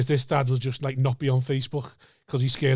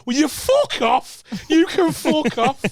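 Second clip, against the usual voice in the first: high, loud, drawn-out outraged yell; retold scream; 12 semitones higher, 5 dB louder, much slower than usual. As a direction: scream it in disbelief, stretching the words. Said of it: "You just hear me scream".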